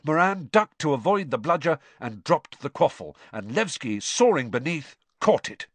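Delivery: ordinary delivery, nothing marked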